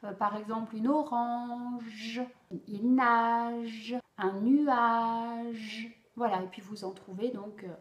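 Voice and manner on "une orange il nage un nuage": "orange", "nage", "nuage" slow and louder on the final "ge"; stress final j. Shown as a demonstration. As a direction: stretch the end of each word. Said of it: "vous insistez sur le son à la fin du mot".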